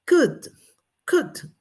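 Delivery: COOKED as one loose syllable, "kud"; approximate ending. Shown as a demonstration. Teacher showing what forgetful learners say, approximate ending. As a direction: say COOKED roughly, softening the final T. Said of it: "no nos acordamos. Entonces decimos".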